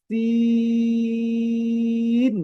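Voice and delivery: সিন hissed at the start, then held high and loud; a long s into a sustained one-note ee, ending in n; pressure-cooker hiss. Shown as a demonstration. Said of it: "pressure কুকারে একটি sound হয়". (প্রেসার কুকারের মতো লম্বা সিন)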